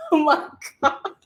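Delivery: high, helpless laughter; laughing in disbelief. Real, not acted. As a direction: laugh through the exclamation at how ridiculous it is. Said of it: "I'm laughing because it's so ridiculous".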